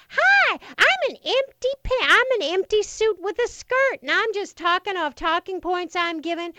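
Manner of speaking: mock Palin like a sketch. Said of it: "Palin's running around going".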